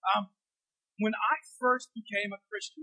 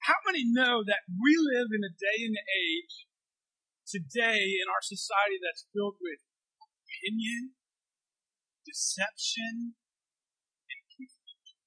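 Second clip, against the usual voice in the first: slow, loud pulpit delivery; forceful preaching; 6 dB louder, slower than usual. Acted. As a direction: preach it out to the whole room. Preaching to the congregation.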